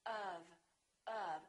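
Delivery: schwa sound of; the little sound of. Shown as a demonstration. Teacher models the sound "of". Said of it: "it sounds like this".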